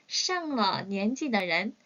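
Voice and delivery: very slow, each syllable of 上了年纪的人 apart; slow modelled phrase. Shown as a demonstration. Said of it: "slow modelled phrase".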